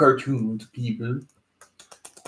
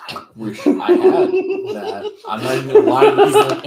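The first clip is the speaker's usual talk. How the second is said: talking through real laughter; giggling, voice pitched up high; laughing; 11 dB louder than usual. Real, not acted. Laughing while admitting it.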